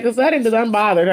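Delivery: normal talking voice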